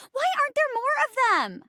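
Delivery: high-pitched fangirl wail; excited lament. Real, not acted. Player gushes over grandma characters, high excited plea. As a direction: gush it high, in mock exasperation.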